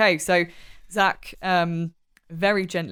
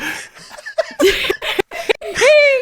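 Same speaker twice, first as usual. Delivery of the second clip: long, high, loud laugh; high peals of laughter; 7 dB louder than usual. Real, not acted. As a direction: burst out laughing. Laughing before descending the hole.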